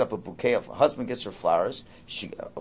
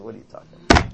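exasperated; 3 semitones lower, 8 dB louder, much faster than usual. Retold or imitated. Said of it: "I said".

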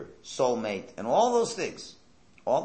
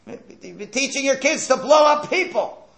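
high, loud, drawn-out protest; outraged shout; 12 dB louder than usual. Acted. Preacher objects to sympathy for bombers, outraged shout. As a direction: shout it in high-pitched indignation. Heated mock argument over bombers.

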